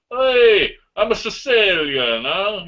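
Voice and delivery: high-pitched, half-hearted Sicilian tough guy; a hint of a New York mobster, slow; Robert De Niro impression. Podcaster mocking a casting choice, lightly voiced mobster. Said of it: "Robert De Niro in it going like this".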